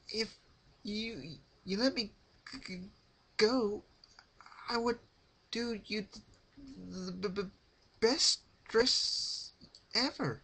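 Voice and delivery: high, stuttering, out of breath; gasping plea. A narrator's reading. Rarity bargaining with Pinkie between gasps. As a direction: gasp out the bargain between sobs of air. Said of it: "she added in between gasps".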